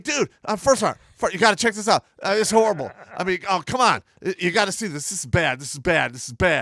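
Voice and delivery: loud, high re-enacted panic; urgent pleading, settling into insistent repeats; frantic plea. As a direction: beg a superior to come look. Retells frantic plea to sergeant.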